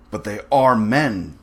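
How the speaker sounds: indignant, leaning slowly on the words; hot protest. A narrator's reading. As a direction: protest with a touch of heat. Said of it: "said Rainsford hotly".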